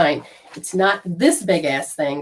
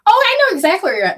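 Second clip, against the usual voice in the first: quick, high-pitched operator voice; bright recognition; 11 semitones higher, 4 dB louder, faster than usual. Retold or imitated. Operator to lost caller, excited recognition. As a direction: pipe up fast and high, imitating the operator.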